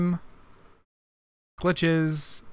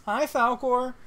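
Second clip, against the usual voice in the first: cooing greeting; 6 semitones higher, slower than usual. Real, not acted. Greeting the lizard.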